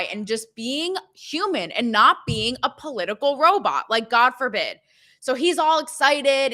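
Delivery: ordinary delivery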